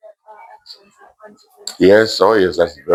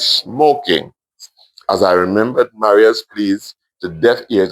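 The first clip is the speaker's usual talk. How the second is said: ordinary delivery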